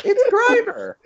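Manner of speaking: high, loud excited squeak; high squeaky voice. Acted. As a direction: squeak it out with glee. Joke voice.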